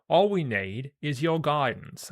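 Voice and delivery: loud, high Cockney warrior; failed Aussie sliding into Cockney; Cockney accent. Acted. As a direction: call it out in broad, rough Cockney. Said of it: "I'm fucking doing Cockney for him".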